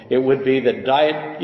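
ordinary talk

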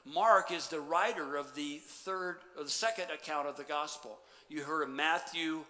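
ordinary talk, nothing marked

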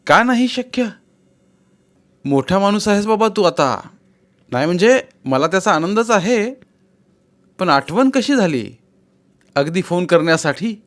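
wry, a touch guarded; Vaibhav. A narrator's reading.

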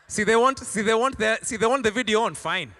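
mocking them, loud and sharp. Preacher mocks members faking obedience, loud scoff. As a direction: scoff at half-hearted obedience.

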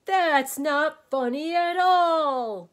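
loud, high, drawn-out mock sob; sobbing wail. A narrator's reading. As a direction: wail it slowly and hurt. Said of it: "sobbed the din".

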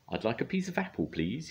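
a hint of a chirpy bird; lightly voiced small bird; high little blackbird voice. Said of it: "he said".

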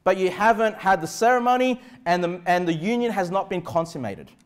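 ordinary talk, nothing marked

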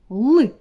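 short, loud, isolated "Л"; окремий звук л. Shown as a demonstration. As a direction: say "Л" alone, with force. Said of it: "Який у нас наступний звук?". (коротке, гучне, окреме "Л")

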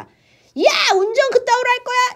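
high, loud mock yell at a reckless driver; angry yell at driver. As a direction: shout at the speeding driver out the window. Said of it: "이렇게 말해주고 싶겠죠?". (과속 운전자에게 창밖으로 소리치듯 화난 고함)

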